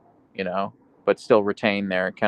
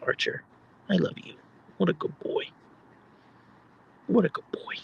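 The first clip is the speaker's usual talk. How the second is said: higher coo; pet talk; 7 semitones higher, 5 dB quieter than usual. Acted. Streamer praising her good boy, higher coo. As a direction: praise the dog in a lifted voice.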